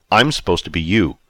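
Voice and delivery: usual speaking voice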